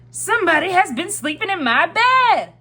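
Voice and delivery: great big bear voice, loud and high-pitched; Papa Bear. A narrator's reading. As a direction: boom it out like an outraged big bear. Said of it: "in his great big voice".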